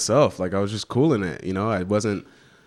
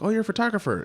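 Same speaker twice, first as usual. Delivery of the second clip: high, bright impressed aunt; faster than usual. Retold or imitated. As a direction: pitch it way up, impressed.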